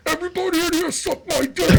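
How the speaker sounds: high, yelling into the walkie-talkie; squealing shouted prank call. Retold or imitated. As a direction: blast it out like a prank over the speaker. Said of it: "I'd be like this".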